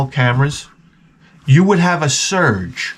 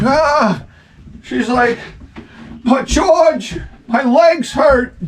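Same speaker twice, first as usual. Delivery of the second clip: high mock wail of pain; pained wife; 13 semitones higher, much slower than usual. Wife complains to husband about her legs, high pained whine. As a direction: play the hobbled wife crying out, pitched way up.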